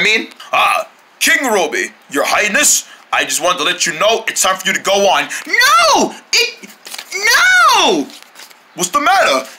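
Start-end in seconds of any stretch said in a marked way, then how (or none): 5.5-8.1 high frantic cry of no; panicked no. Acted. King panicking when told it's time, crying no.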